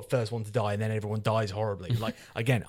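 ordinary talk

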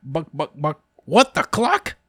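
squawky high chicken voice, drawn out; chicken devil voice. Acted. Chicken devil clucking a pun at the class. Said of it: "Bucky goes".

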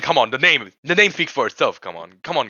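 usual speaking voice

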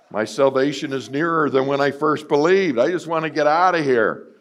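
hammed-up yearning; mock eager longing. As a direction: sell it like a man ready to go. Voicing his younger despairing self.

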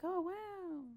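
high, very soft wow; hushed, high-pitched imitation of wonder; awed little "oh, wow" from an impressed onlooker; mock awe. Acts out awed tour guests.

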